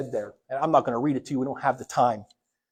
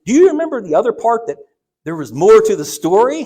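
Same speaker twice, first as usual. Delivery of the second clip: eager pressing; 7 semitones higher, 11 dB louder than usual. Retold or imitated. Son pressing father again.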